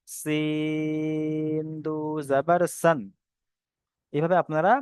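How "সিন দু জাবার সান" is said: সিন held very long on an even tone; long letter stretch. Shown as a demonstration. Teacher reciting the tanween drill for students, drawn-out recitation. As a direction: slowly stretch the "ই" of সিন before দু জাবার সান.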